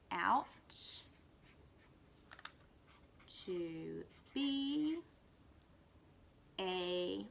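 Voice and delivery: one word at a time with long pauses; reading a sentence slowly, word by word. Shown as a demonstration. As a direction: read word by word, slowly and softly. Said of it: "by slowing down and stretching out the word".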